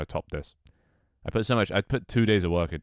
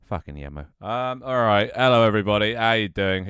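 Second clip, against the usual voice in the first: ordinary talk, nothing marked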